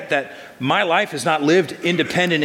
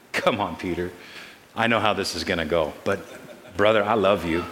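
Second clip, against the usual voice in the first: smiling Jesus; 5 semitones lower, 4 dB quieter than usual. Acted. Jesus tells Peter he loves him, low and smiling. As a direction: lower and softer, fond and amused. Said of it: "see the smile on Jesus' face".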